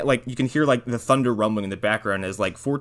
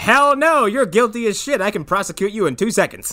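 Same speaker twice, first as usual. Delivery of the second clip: high, loud mock scolding; hammed-up outrage, then easing; a joking prosecutor tells Santa he's guilty; 9 semitones higher, 6 dB louder than usual.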